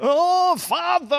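high, drawn-out theatrical cry; Matt Berry. Retold or imitated. Podcaster showing off an actor impression, high theatrical cry. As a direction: imitate an actor calling out, pitched well up. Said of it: "du machst das super".

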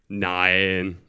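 put-on low-pitched voice for the boy; boy's answer. A narrator's reading. (tiefe Jungenstimme beim Antworten)

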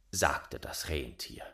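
plain reading voice

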